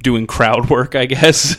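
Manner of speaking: ordinary delivery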